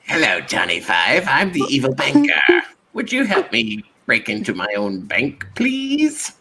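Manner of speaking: hammed-up villain with swooping pitch; cartoonish evil banker making a request. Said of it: "if he talked like that".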